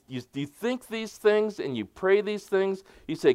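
normal talking voice

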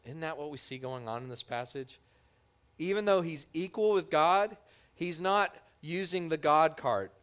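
ordinary talk

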